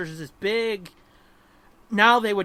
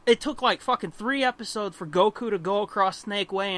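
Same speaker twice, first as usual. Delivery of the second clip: normal talking voice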